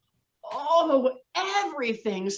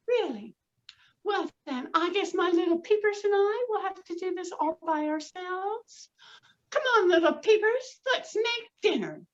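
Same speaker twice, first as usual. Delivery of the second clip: high, chirpy mother hen; a busy hen calling her chicks; little red hen; 8 semitones higher than usual. Acted. Storyteller voicing the hen to her chicks, high and bright. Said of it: "And the little red hen said".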